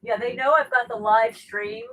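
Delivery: ordinary talk, nothing marked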